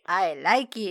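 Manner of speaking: drawn-out mimicry of a badly faked American; fake-American accent. Co-host repeats the supposed American's line, in mock accent. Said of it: "does not even sound remotely like he's from the States".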